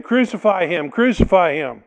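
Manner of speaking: building shout of crucify him. Acted. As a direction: let the mob's cry swell. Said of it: "Getting louder and louder".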